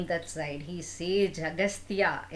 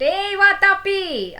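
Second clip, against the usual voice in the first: loud, much higher, slow drawn-out shout; desperate call; 12 semitones higher, 9 dB louder than usual. Acted. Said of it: "And again Ilvala went".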